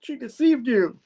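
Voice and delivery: high-pitched comic protest; hammed-up falsetto reasoning; Peter pleading to reason. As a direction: plead innocence in a squeaky mock voice. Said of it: "trying to reason her".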